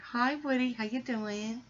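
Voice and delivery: high sing-song hello to a pet. Real, not acted. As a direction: coo up high at the dog. Greeting the pet Woody.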